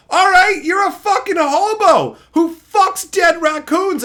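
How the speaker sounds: loud, high, no holding back; unrestrained insult. Shown as a demonstration. Streamer roasting a viewer on request, loud and high. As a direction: let the insult fly, full force. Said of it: "don't hold back".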